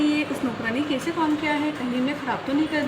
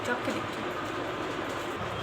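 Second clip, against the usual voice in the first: slow whispered aside; 4 semitones lower, 10 dB quieter, much slower than usual. Acted. Mother whispering to herself before checking on the maid. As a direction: breathe it to yourself, unvoiced.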